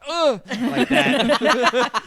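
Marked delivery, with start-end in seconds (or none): 0.1-0.4 loud, high "ugh"; exaggerated startled groan; imitated recoiling groan. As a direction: groan like someone hit by a bright light. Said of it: "other side of her went".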